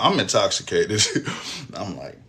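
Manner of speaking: ordinary delivery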